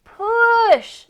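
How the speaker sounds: loud, high cry of push; shouted command. Acted. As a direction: bark the order to push. Goat urging hen to push.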